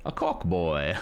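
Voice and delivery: badly faked Irish accent from Cork; jokey stab at a Cork accent; attempted Cork accent. Shown as a demonstration. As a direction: try a playful, amateur Cork Irish lilt. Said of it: "I can't do the accent".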